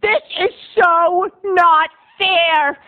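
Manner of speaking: high, drawn-out indignant wail; piping up in protest. Acted. Jealous karaoke regular interrupts the singer, wailing. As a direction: cut in with a slow, hammed-up whine. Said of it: "piped out".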